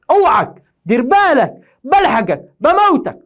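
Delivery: high, loud mock threat; تهديد مازح. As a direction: warn the pupil off loudly, playfully menacing. Mock threat warning pupils. (تهديد مازح بصوت عالٍ)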